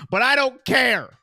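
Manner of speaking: loud, emphatic declaration; defiant passion. Real, not acted. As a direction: push every phrase out loudly and firmly. Defiant declaration of love for player.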